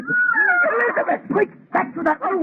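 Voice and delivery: desperate shout down the corridor; frantic calling out. Acted. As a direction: shout for her in sudden alarm. Warns of monster at her room.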